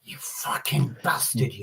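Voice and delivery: slow, whispered menace; aggressive. Shown as a demonstration. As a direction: drag the insult out in a threatening hush. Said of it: "That's aggressive".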